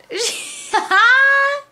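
high, loud squeal of joy; overjoyed shriek-laugh; thrilled squealing laugh. Real, not acted. Thrilled by surprise gift.

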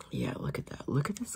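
hushed whisper of wonder. Real, not acted. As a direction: whisper it, marvelling. Whispering at glitter.

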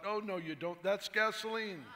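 high, loud alarmed yell. Acted. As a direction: yell to stop the nurse. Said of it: "Doctor Young yells out".

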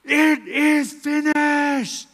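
very slow, high and loud, each word stretched; loud final cry. Retold or imitated. Jesus on the cross crying out his last words, drawn out. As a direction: cry it out in one last breath. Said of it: "he cries out".

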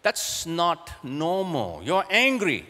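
lightly voiced son noticing dad is angry. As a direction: play the son calling out dad. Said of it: "my son picks it up as".

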